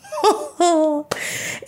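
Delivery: high, drawn-out gleeful chuckle; delighted laugh. Acted. Reader finding someone who loved the same book, delighted laugh. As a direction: laugh as if you've struck gold.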